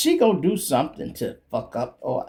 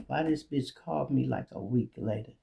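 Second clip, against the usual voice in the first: ordinary talk, nothing marked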